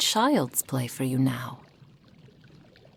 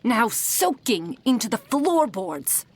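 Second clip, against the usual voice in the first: exasperated, raised-voice tirade; furious scolding; 3 semitones higher, 4 dB louder than usual. Acted. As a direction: berate a careless apprentice, voice swooping up on key words. Mentor scolds apprentice over vial.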